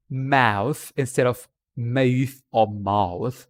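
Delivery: showing how accents shape mouth; accent demo of mouth. Shown as a demonstration. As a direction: mouth said very slowly, three ways, each in a different accent. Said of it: "the way they move their mouth".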